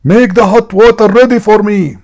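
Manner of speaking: high, loud bossy husband yell; mock domineering shout; acted scream. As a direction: holler an order at your wife. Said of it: "he screamed".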